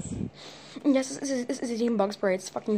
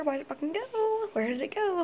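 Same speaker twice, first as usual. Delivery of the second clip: scared; 8 semitones higher, 3 dB quieter than usual. Real, not acted. Panicked losing sight of spider.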